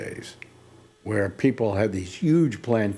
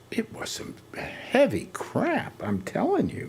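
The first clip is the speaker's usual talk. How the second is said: high emphatic; 12 semitones higher, 5 dB quieter, slower than usual. Real, not acted. Emphatic claim about strong weed.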